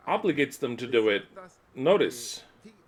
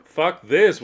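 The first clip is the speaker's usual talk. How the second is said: loud, higher-pitched brush-off; defiant retort; 5 semitones higher, 9 dB louder than usual. Acted. Answering the nations over the islands' name, loud defiant retort. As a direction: throw it back at them loudly. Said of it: "we'll say".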